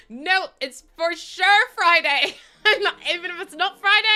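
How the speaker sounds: high, loud protest through laughter; laughing insistence. Real, not acted. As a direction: insist loudly while cracking up.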